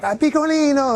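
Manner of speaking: very high, gushing Italian nonna voice; swooning over the kids in Rome, high and gushing; Italian lady. Retold or imitated. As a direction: coo it like a doting Roman grandmother. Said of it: "Every lady is like".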